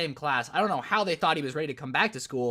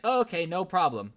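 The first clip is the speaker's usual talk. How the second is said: usual speaking voice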